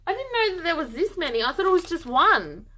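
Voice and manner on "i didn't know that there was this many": ordinary delivery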